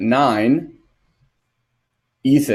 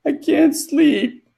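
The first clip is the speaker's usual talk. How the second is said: put-on much higher, slow, small weepy boy; little boy voice; slower than usual. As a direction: whimper it like a sleepless kid. Crying child quoted.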